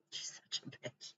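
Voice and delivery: breathy whispered aside. Real, not acted. Vlogger to her viewers, a hushed whisper. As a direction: whisper it so only the camera hears. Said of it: "whispered aside to viewers".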